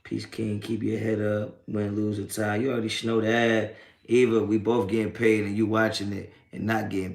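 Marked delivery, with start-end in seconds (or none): none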